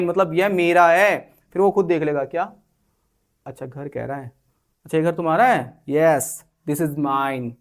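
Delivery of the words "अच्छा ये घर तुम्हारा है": normal talking voice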